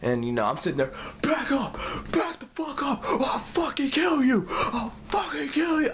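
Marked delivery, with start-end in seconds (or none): 1.2-5.9 high, strained mock screaming threats; frantic squeezed yell without full volume; going off. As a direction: reenact a berserk threat, pitched way up. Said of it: "I just go off on these guys".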